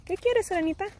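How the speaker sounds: high, sweet question. Real, not acted. Mom asking Serenita what she wants, high sweet question. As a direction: ask a little one what she wants. Toddler wants something mid-video.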